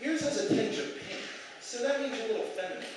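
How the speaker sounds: put-on high, sing-song girly take; lightly mock the date's dreamy tone; mock girlfriend voice. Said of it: "And she's like".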